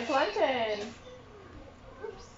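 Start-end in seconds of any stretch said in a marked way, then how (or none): none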